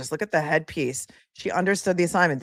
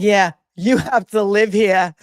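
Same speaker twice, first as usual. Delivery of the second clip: slow, louder Yeah, you have to live here in a New York voice; mimicking New York accent; 6 dB louder, slower than usual. Vlogger mimics the clerk's shade, mock New York accent. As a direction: throw shade like a New Yorker. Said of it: "She's like".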